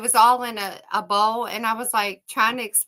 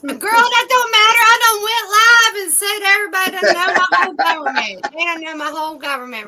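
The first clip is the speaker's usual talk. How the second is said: high, raised-voice protest; loud playful protest; 8 semitones higher, 5 dB louder than usual. Real, not acted. Woman telling Renee everybody knows her government name, loud protest. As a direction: holler it at a friend, high and loud.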